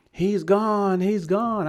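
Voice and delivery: high-pitched retold cry of distress; mock scream. Storyteller imitating a distraught woman at the airport, mock scream.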